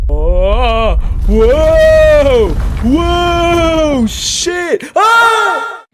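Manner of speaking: high loud yelps of shock; startled screaming. Real, not acted. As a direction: shriek as the screen goes wild. Screen suddenly spins wildly.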